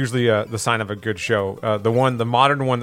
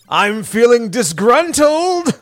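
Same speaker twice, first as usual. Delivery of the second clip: cartoon character quote; 11 semitones higher, 4 dB louder, much slower than usual. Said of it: "Every now and then I go".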